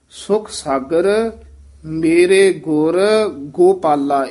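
ਗੁਰ ਗੋਪਾਲਾ slow, with ਗੁਰ set apart from ਗੋਪਾਲਾ; careful separation. Shown as a demonstration. Teacher shows ਗੁਰ ਗੋਪਾਲਾ carefully split. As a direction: slowly pull ਗੁਰ away from ਗੋਪਾਲਾ. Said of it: "ਥੋੜ੍ਹਾ ਜਿਹਾ ਧਿਆਨ ਨਾਲ ਉਚਾਰਨ".

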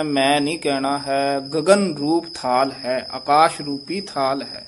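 ordinary talk

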